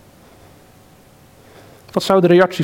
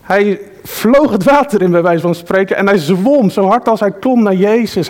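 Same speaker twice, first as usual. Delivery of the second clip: ordinary talk, nothing marked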